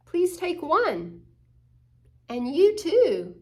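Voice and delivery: put-on generous child offering cookies to everyone, kindly. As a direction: share the cookies sweetly. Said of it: "generous means offering some to others".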